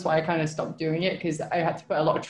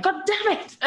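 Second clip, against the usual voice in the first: mock frustrated squeal; 13 semitones higher than usual. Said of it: "I'm like".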